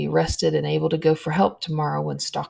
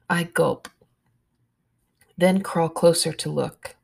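plain reading voice

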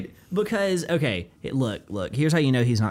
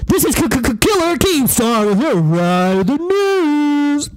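much higher, much louder, slow blown-out yell; Keemstar impression; 11 semitones higher, 8 dB louder, slower than usual. After a headphone warning, friend screaming Keemstar's intro. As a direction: blast it into an overdriven mic. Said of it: "headphone warning".

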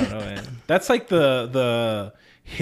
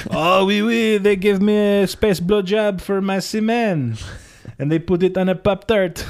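hammed-up French accent, loud; french chef; 5 semitones higher, 4 dB louder than usual. Mock French character.

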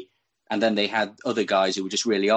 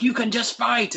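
mock Rob Lowe line; 12 semitones higher than usual. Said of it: "he's just like".

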